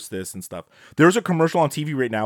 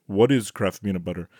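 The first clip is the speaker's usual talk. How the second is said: lightly imitated French accent, pitched lower; 5 semitones lower than usual. Host retelling the boyfriend's ad line, light French accent. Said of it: "he says in his French accent".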